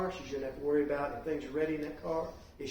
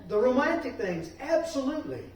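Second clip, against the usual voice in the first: excited; 9 semitones higher, 8 dB louder than usual. Real, not acted. Teacher agrees enthusiastically.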